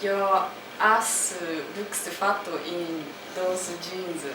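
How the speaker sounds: broken-up Japanese-accented English, vowels added after consonants. Shown as a demonstration. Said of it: "speak in this Japanese accent".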